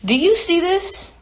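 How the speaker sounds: indignant shocked question; outraged disbelief. Real, not acted. Outraged at what she finds.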